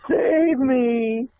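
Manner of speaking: high, piercing mock plea from the nun; nun's cry for help. The injured nun begging the class, a high mock cry. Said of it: "and she says".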